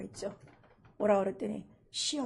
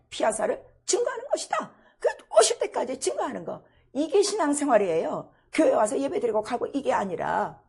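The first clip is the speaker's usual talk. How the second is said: loud, high fervent preaching; impassioned loud sermon climax; 3 semitones higher, 11 dB louder than usual. Acted. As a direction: preach it out with full force. Preaching at full force.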